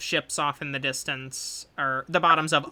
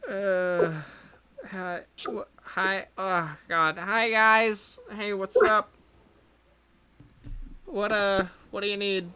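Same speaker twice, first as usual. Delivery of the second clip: a touch higher, dazed scientist; slightly higher, caught off guard; groggy lab scientist; 4 semitones higher than usual. Acted. GM plays groggy Deb.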